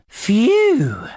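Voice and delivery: high, drawn-out phew of relief; relieved phew. Acted. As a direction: let out a big acted phew. Relief after a near disaster.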